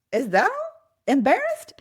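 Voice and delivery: father brushing off the fear; incredulous dad. Retold or imitated. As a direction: scoff lightly as if it's nothing. Dad dismissing her worry.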